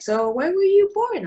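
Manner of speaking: high, loud mock of a nosy stranger; mocking questioner. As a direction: pitch it up like a clueless interrogator. Strangers quizzing her origin.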